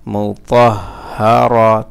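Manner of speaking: "مطهرة" slow and connected; joined reading pronunciation. Shown as a demonstration. Teacher giving learners the whole word, slowly joined. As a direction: read "مطهرة" as one measured word. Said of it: "reading উচ্চারণ".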